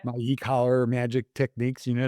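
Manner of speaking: ordinary delivery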